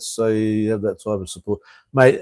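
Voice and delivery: ordinary delivery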